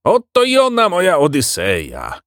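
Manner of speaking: high exclamation, settling at the end; showing off with a high-pitched cry; proud shout. A narrator's reading. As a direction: cry it high, then come down. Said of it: "krzyknął Verganza".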